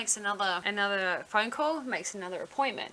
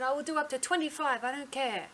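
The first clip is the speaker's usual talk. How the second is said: higher, badly faked Indian accent; attempted Indian accent; 5 semitones higher than usual. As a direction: imitate the doctor's Indian accent, pitched up. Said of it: "I can't do the accent".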